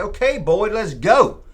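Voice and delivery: high, loud teasing call; mock-friendly send-off; playful push.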